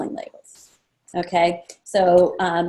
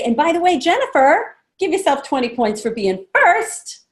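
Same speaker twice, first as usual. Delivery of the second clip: playful award; 5 semitones higher, 4 dB louder than usual. Shown as a demonstration. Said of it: "I'd say".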